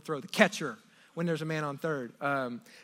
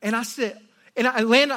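ordinary delivery

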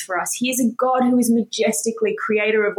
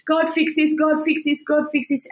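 higher, repeated mock pleading to God; whiny pleading; 6 semitones higher than usual. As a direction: nag God like a complaining child. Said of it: "doing my list of complaints".

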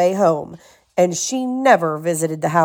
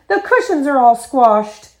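higher, dismayed mother bear; indignant storybook mother; Mommy Bear exclaiming; 5 semitones higher than usual. A narrator's reading. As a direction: exclaim in raised pitch. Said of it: "Mommy Bear exclaimed".

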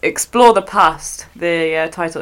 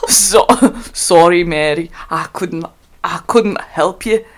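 halting, lower-key Scottish with restarts; Scottish accent attempt; 3 semitones lower than usual. Shown as a demonstration. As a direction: feel your way into a Scots accent, slowing at the end. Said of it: "do a better one myself".

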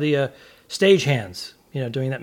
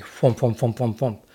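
lower, rhythmic thumping sounds; thump noises; 3 semitones lower than usual. Retold or imitated. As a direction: mimic shoes thudding down. Sneakers landing on stage.

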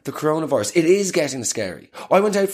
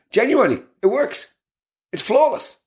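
much higher, pleased with himself; excited boast; 8 semitones higher than usual. Real, not acted. Bragging to listeners, excited and much higher. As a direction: crow about the trick. Proud boast, high-pitched.

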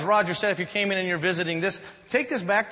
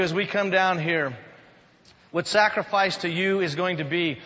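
ordinary talk

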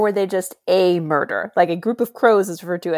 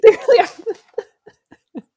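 laughing through the words; giggling agreement; 13 semitones higher, 5 dB louder, much slower than usual. Real, not acted.

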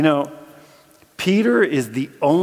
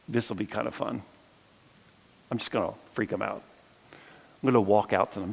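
low, soft, private musing; quiet mock scheming to oneself; sly aside; 4 semitones lower, 7 dB quieter than usual. Jesus to himself, planning to surprise the disciples, sly aside.

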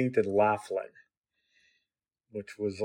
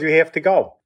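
higher, emphatic retold command; insistent order; 6 semitones higher, 4 dB louder than usual. Father ordering his son's friend out, insistent. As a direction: retell a father firmly repeating his order.

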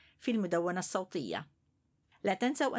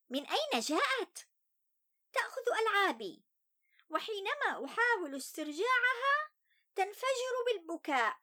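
put-on higher young boy's voice, sulky; child voice; 9 semitones higher than usual. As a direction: tell it as an upset little boy. First-person child narrator Ali.

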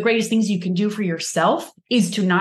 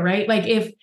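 ordinary talk, nothing marked

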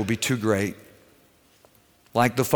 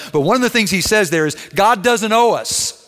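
preaching; 8 semitones higher, 3 dB louder than usual. Acted. Preacher building to full force.

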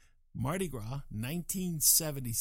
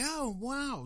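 high delighted gasp of a word; light up, pitch jumps high; pleasantly surprised; 12 semitones higher than usual. Retold or imitated. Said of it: "I look at that and I say".

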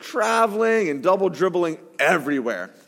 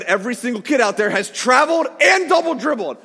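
higher, louder insistent shout; 6 semitones higher, 4 dB louder than usual. Retold or imitated. A father shouting at a parent about the referee's calls. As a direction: hammer the point home. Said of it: "I said".

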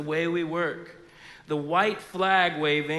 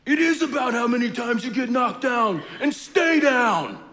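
higher, louder hammed-up pep talk; mock Rocky speech; 6 semitones higher, 4 dB louder than usual. Pastor pretending Rocky gives a quitter's speech. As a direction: ham up a backwards movie pep talk. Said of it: "Rocky was like".

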